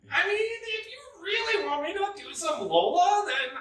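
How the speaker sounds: way up high, sassy diva strut; high sassy drag-queen voice; Lola voice. Pretend. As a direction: camp it up as the queen witch Lola. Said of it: "Are you gonna give us a little Lola?".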